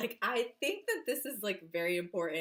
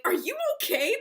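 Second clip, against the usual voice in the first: worried mom; 11 semitones higher, 7 dB louder than usual. Retold or imitated. Mom checks on her scream.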